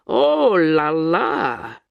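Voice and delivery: loud scoffing exclamation; scoffing cry of a Russian woman; cried out. A narrator's reading. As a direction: cry it out in mocking disbelief. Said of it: "cried Sasha".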